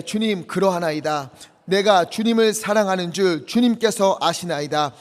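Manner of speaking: "주님, 그러하나이다" read out firm and loud; reading the verse aloud with the congregation. Acted. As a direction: proclaim the verse boldly. Scripture read aloud together.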